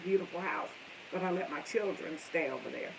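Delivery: ordinary talk, nothing marked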